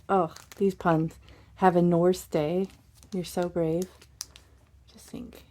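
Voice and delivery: ordinary talk, nothing marked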